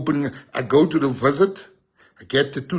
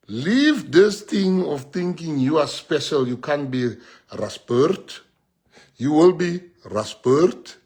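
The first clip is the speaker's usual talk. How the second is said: slow emphatic warning; slower than usual. Real, not acted. Preaching warning at audience.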